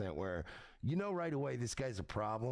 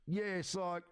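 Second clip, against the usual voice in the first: much higher, hammed-up English geezer voice; mock English accent; 10 semitones higher than usual. Imitating James threatening someone, higher mock English geezer. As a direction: ham up a London tough guy. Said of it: "you hear James and he's like".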